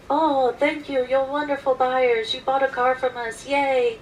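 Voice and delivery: sing-song sarcastic cheer; mock gushing. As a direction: imitate a gushing sales crowd. Mock clapping salespeople.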